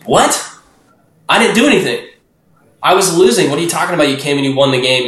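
high, incredulous what, drifting back down; can't believe you won, voice jumps up then settles; stunned disbelief. Retold or imitated. Disbelief at Jesus' win.

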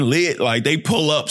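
ordinary delivery

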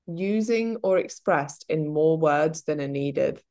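ordinary delivery

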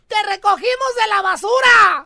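hammed-up furious mom shout; angry mom. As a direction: yell it like a fed-up mom scolding her kid. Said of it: "mamás cuando andan enojadas".

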